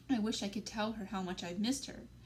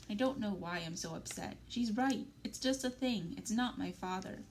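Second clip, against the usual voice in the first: plain reading voice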